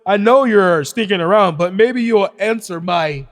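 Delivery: high mocking call to the hidden monster; teasing sing-song voice. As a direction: coax the monster playfully in a high voice.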